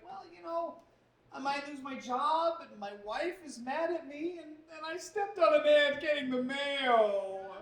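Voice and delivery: high, slow, blubbering lament; mock sobbing. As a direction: wail it out like an ugly crier. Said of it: "crying a lot".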